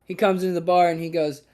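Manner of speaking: ordinary talk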